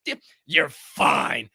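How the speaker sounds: mocking, drawn out. Shown as a demonstration. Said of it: "Shut the fuck up with that fine".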